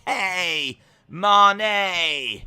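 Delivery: high, loud, stretched-out call; catchphrase holler. Acted. Streamer shouting a catchphrase to the chat, drawn-out holler. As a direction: holler it with long falling vowels.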